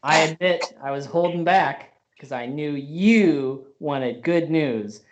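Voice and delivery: normal talking voice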